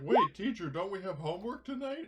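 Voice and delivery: high, loud keen-pupil voice; mock eager schoolkid. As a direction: ham up the kid reminding teacher. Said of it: "It's like".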